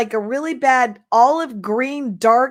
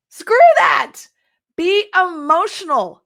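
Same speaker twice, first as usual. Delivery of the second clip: defiant outburst; 6 semitones higher than usual. Real, not acted. Defying advice to women.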